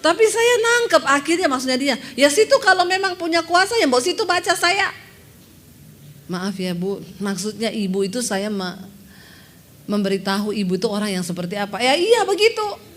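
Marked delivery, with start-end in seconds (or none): 2.2-4.9 loud, high, scornful retort; rude challenge. Retold or imitated. Rude woman challenging the preacher. 11.8-12.9 loud, high, scornful retort; rude challenge. Retold or imitated. Woman retorting to preacher.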